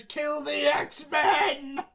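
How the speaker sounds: slow, drawn-out shouted command; a voice in his head barking kill; a slow, looming shout from inside his head; echoing command voice. Retold or imitated. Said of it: "a voice echoing within him that just says".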